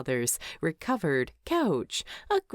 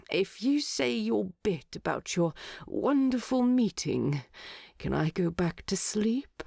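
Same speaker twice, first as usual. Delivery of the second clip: sleepy plea; 3 semitones higher than usual. A narrator's reading. Sleepy Bilbo pleads, whisper.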